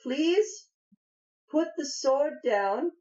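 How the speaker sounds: high, measured halfling voice; pleading halfling. Acted. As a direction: talk down an armed stranger, high-pitched. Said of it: "She says".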